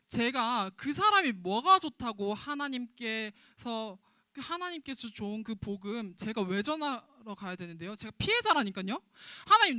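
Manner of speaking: loud, indignant protest to God; angry complaint to God. Retold or imitated. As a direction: cry out at God like a wronged man. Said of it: "이게 요나의 입장이었어요".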